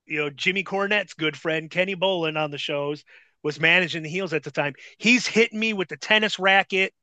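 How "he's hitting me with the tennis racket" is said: ordinary delivery, nothing marked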